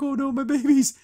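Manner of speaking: high, whiny mock pout; playful pouty lament. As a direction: imitate someone pouting over a loss. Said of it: "like, pouting about it".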